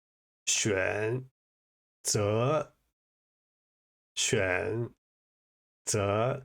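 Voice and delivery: 选 择 split apart, tones chopped word by word; halting learner tones; beginner's wrong tones. Shown as a demonstration. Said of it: "my inclination is to say".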